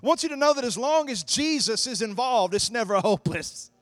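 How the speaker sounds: loud, rising preacher's call to the congregation; preaching at full force. Acted. Preacher rousing the congregation with hope, loud and fervent. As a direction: drive it home like a revival preacher.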